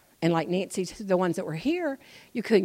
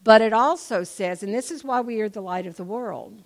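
ordinary talk

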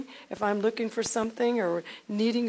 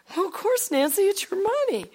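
much higher, louder easy husband; husband's voice; 12 semitones higher, 7 dB louder than usual. Retold or imitated. Husband granting his wife's request, high and louder. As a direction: shrug it off, it's hers.

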